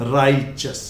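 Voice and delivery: "righteous" said as RAI-chus; a ch in the middle of "righteous"; correct pronunciation. Shown as a demonstration. Teacher repeating the right form, said correctly. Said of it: "कभी भी righteous नहीं बोलेंगे".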